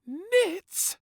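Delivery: very high, loud shocked cry; a squeaking, loud "Nits?"; shocked gasp. A narrator's reading. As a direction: react in horror. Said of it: "gasped the General".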